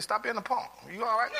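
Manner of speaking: wife's mock scolding. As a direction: tease him like his wife would. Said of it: "She said".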